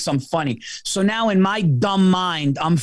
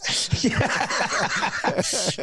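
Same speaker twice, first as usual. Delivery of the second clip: high breathy laugh; 6 semitones higher, 3 dB quieter than usual. Real, not acted. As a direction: laugh under your breath. Laughing at the story.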